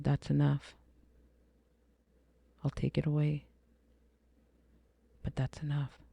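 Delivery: soft, restrained quoting of a divine voice; gently imitating a voice from above; beautiful divine voice. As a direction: say it softly, with calm finality. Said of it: "I heard the most beautiful voice".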